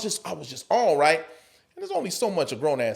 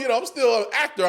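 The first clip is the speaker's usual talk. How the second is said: ordinary talk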